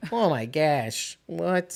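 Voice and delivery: slow, drawn-out mock Midwestern accent. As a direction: imitate a friend's Midwestern accent slipping out. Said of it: "I could see your accent dripping out".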